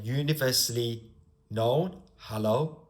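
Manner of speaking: normal talking voice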